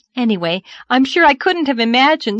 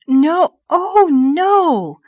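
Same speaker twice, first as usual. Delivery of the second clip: high, loud flustered denial; alarmed cry; 9 semitones higher, 8 dB louder, much slower than usual. A narrator's reading. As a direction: cry out a hasty, embarrassed protest. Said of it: "cried Anne, flushing so guiltily".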